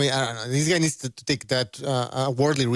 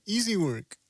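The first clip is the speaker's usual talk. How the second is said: mock New Zealand accent, pitched up; 7 semitones higher, 3 dB quieter, slower than usual. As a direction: say it like a cocky Kiwi boxer. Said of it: "Easy work with the, uh, New Zealand accent".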